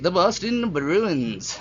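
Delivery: slow, drawn-out put-on announcer voice; odd voice. Vlogger announcing the next team, slowly, in a put-on voice. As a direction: stretch it out in a funny odd voice. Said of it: "I don't know why my voice went like that".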